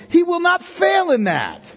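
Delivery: triumphant preaching shout. Real, not acted. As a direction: proclaim it to the back row. Preacher's climax on God's faithfulness.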